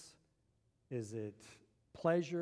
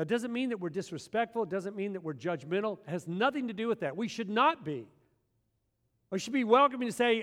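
usual speaking voice

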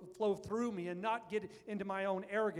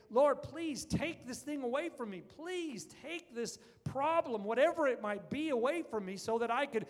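impassioned plea; 7 semitones higher, 4 dB louder than usual. Acted. Paul begging God to remove suffering.

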